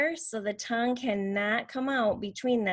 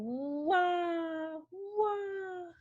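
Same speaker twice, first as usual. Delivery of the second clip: high, drawn-out mock wail; imitated baby crying; 8 semitones higher, 4 dB quieter than usual. Shown as a demonstration. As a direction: wail "wah" like a baby, playfully. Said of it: "like a baby crying".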